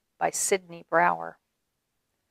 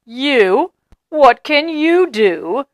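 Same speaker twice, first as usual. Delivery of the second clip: mocking; 5 semitones higher, 8 dB louder, slower than usual. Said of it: "how they laughed".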